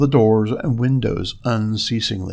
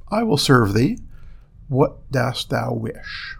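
ordinary delivery

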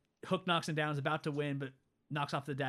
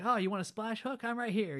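cocky Peter taunt; 7 semitones higher than usual. Retold or imitated. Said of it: "Peter Pan shows up like".

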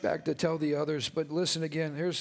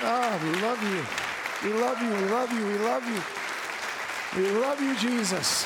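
praise break worship; 5 semitones higher than usual. Real, not acted. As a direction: pour out praise at full force.

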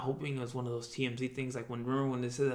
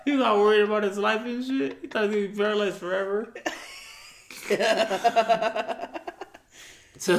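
laughing; 9 semitones higher, 9 dB louder than usual. Real, not acted. Laughing retelling of friend's worry.

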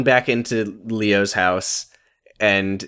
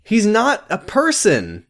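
heated protest. Real, not acted. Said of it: "it's fun to do the voice".

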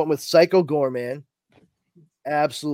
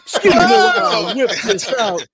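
higher, louder laughing quote; Blazing Saddles impression; 7 semitones higher, 8 dB louder than usual. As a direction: ham up the movie line. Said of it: "Excellent Blazing Saddles reference".

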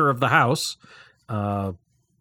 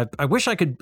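normal talking voice

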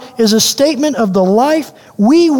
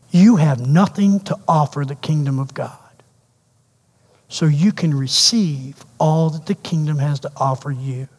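much lower and softer, tender reassurance; gentle Jesus; 8 semitones lower, 5 dB quieter than usual. Acted. Jesus reassures the outcast he is salt, low and tender. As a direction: speak it low and soft, like comfort.

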